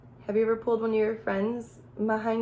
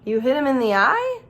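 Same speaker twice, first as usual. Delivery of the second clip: ordinary delivery, nothing marked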